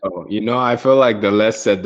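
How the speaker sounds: normal talking voice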